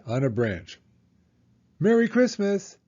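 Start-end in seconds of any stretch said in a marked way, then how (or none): 1.8-2.8 higher, louder festive call; joyful greeting. Acted. Dragon giving the birds a home, joyful and high. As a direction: sing it out to the birds. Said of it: "said Dragon".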